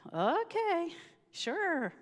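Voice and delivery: high, doubtful okay sure; skeptical sure. Retold or imitated. Agreeing to pray with her friend, high and doubtful. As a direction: humour her, unconvinced.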